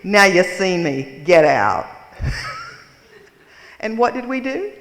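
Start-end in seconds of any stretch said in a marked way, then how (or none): 0.0-1.9 put-on gruff old woman. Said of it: "And she goes". 1.9-2.8 high laugh. Real, not acted. Laughing at her own story.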